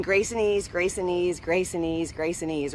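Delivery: calm chant trailing off to near silence; mantra fading to a murmur. Shown as a demonstration. Demonstrating her calming plane mantra, fading softly. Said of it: "I do this mantra on the plane".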